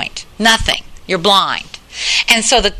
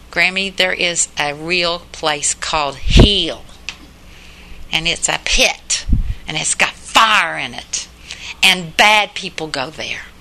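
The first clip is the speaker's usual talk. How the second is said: put-on slow, lower, hushed retelling of a small girl; little girl's voice; 6 semitones lower, 3 dB quieter, slower than usual. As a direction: softly imitate a serious four-year-old. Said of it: "She talks in this little, little four-year-old voice".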